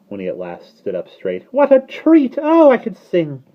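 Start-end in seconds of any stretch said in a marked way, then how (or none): 1.5-3.4 ecstatic bird. Acted. Ecstatic starling voice.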